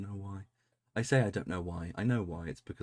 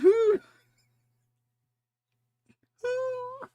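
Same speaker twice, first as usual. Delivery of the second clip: squeaky hoots through tearful laughter; mock hoo; 9 dB louder than usual. Imitating the Elves' hoots mid laughing fit. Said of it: "they're all just going".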